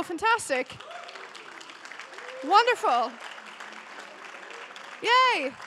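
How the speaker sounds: ordinary delivery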